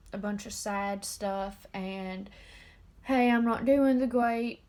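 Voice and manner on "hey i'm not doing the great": louder, hammed-up greeting to viewers; loud mock address to an audience; mock video announcement. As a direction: announce it loudly, as if opening a video. Mimicking herself announcing bad news on video.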